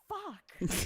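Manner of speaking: high, loud frustrated curse; blooper curse. Retold or imitated. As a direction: swear out at the blooper. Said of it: "we're just like".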